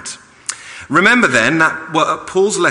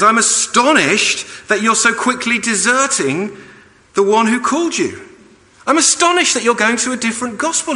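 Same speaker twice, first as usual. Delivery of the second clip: lightly voiced astonished apostle, higher; voicing Paul astonished; 10 semitones higher, 4 dB louder than usual. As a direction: read Paul's words with rising disbelief. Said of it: "he says".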